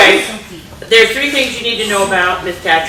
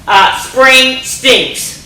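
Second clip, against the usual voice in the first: slow title announcement; 4 semitones higher, much slower than usual. Shown as a demonstration. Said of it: "announcing book title".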